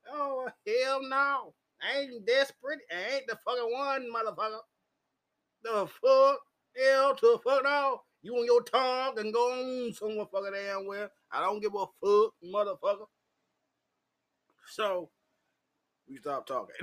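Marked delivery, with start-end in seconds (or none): 0.1-4.6 indignant. Retold or imitated. Refusing to pay, indignant. 5.6-13.1 loud, high furious rant; angry rant. Retold or imitated.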